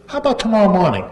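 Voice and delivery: high sarcastic offer; snide. Retold or imitated. Teasing the supposed prankster, high and sarcastic. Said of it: "I'm really being kinda snide".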